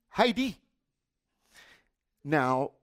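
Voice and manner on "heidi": imitated Indian accent, pitched high. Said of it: "he has an accent".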